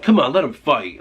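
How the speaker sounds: mildly hyped call to let them go at it; egging on a fight. Retold or imitated. Bystanders urging two brawling friends on, retold goading.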